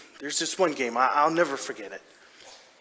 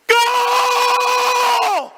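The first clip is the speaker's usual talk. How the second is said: very high, long-held full-out shout; screaming goal yell; 10 dB louder than usual. Retold or imitated. Dad runs down the bleachers celebrating, screaming wildly. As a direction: scream the word and hold it on one high note. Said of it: "screaming and yelling as I run down".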